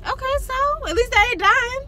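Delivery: heated retort. Real, not acted. Heated argument.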